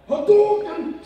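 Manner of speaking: high, loud fighting-game special move cry; Street Fighter Hadouken. Retold or imitated. Recalling crowd stunt, loud Hadouken move call. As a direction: yell it like a fighter firing a fireball. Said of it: "Street Fighter reference".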